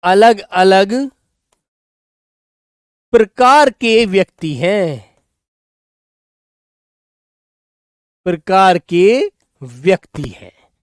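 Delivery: अलग अलग drawn out, slow and spaced; slow dictation pace. Shown as a demonstration. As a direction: stretch अलग अलग slowly for writing. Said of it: "मैंने कहा".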